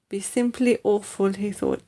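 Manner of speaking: ordinary delivery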